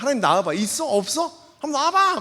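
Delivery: defiant challenge. Retold or imitated. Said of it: "막 이러면서".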